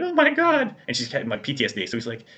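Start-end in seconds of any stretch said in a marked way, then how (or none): 0.0-0.7 high, loud imitated gasp of shock; squeaky mock horror, much higher. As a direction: play the traumatised teller, hammed up. Voicing shocked bank teller.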